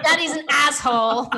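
loud joking insult; mock bitter jab. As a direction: spit it out in jest. Joking about badmouthing dad.

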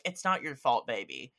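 usual speaking voice

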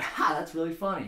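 high, loud, pleased exclamation; happy prisoner. Acted. Joke teller as prisoner who gets the joke, played-up delight. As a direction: act tickled, now in on the joke. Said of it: "He's happy".

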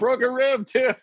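high laughing echo; laughing disbelief. Real, not acted. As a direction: squeak it out through a laugh.

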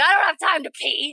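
high-pitched playful mock urgency; mimicked busy child. Teasing guest about her driven childhood, high mock-child voice. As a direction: imitate a tiny person too busy to stop.

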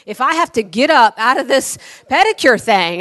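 warning the salesman at full volume; loud stern warning. Retold or imitated. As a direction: shout down the salesman from the pedicure chair.

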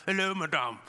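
put-on putting on a French accent; brief amateur French-accent greeting. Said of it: "If that's a good French accent".

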